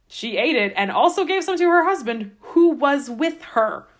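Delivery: "who was with her" punched loud and high; pointed stress. Shown as a demonstration. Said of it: "the way that this sounds to me".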